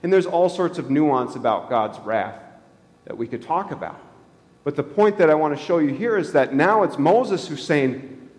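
ordinary talk, nothing marked

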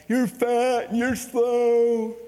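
drawn-out teasing jeer; mock the kids, stretching slow; lightly voiced grown men taunting teenagers. Men mocking the youth.

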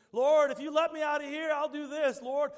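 high loud begging; pleading prayer. Pretend. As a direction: cry out a bargaining prayer. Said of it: "kind of prayer we pray".

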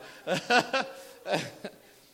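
loud, high laugh; a real laugh; laughing burst. Real, not acted. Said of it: "freaking out".

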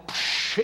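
unvoiced whooshing splash noise; mouth-made water splash; imitated splash. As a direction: make a quick "psh" splash.

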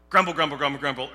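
loud grumbling chant; mock grumbling. Preacher acting out Israel grumbling, loud mock mutter. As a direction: grumble it out loud like a sulky mob.

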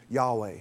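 Yahweh said YAH-way, stress first; Yahweh, low-key teaching pronunciation. Shown as a demonstration. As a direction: sound out Yahweh as two syllables, YAH-way. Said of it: "could also be".

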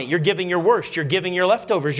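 ordinary delivery

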